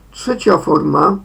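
ordinary delivery

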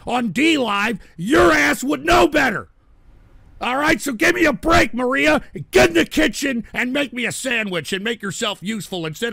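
loud, high-pitched angry shout. Real, not acted. Host berating Maria over a chat accusation, angry shouting. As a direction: blow up at a heckler, shouting. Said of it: "I'm a little angry".

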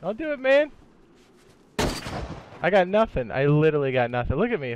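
high desperate pleading shout; panicked surrender shout. Real, not acted. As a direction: beg them loudly not to shoot. Begging armed players not to shoot.

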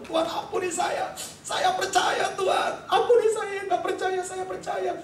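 high, pleading cry; repentant plea. Retold or imitated. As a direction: beg forgiveness in a high, urgent wail. Retold repentant plea to God.

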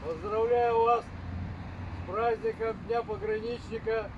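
formal address. Real, not acted. Formal holiday address to the ranks.

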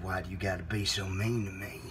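very low, soft restatement; low and quiet. Acted. Host repeating himself to caller, low quiet menace. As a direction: repeat it deep and hushed. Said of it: "I said".

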